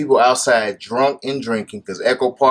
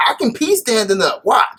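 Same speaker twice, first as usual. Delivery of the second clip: raised-pitch mocking boast; hammed-up brag, pitched up; mock tough woman; 8 semitones higher than usual. Husband teasing wife, mock boastful voice. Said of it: "She's one of them".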